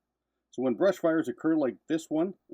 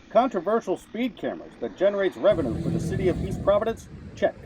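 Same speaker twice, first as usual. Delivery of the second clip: punchy newsreel narration, louder and higher; old newsreel narrator; 6 semitones higher, 5 dB louder than usual. Acted. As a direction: announce it like a vintage newsreel. Punchy rhetorical news checklist.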